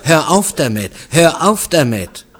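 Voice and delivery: loud, high, insistent protest; angry snapping. A narrator's reading. Woman ordering her suitor to stop, snapping angrily. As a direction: snap it twice, pushing him away. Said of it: "fauchte sie".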